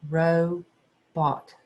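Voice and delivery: "Robot" in two bounced beats, ro and bot; bounce each syllable. Shown as a demonstration. Teacher bouncing out syllables for second graders, rhythmic. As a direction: bounce out "Robot", one beat per syllable. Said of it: "And do your bounce".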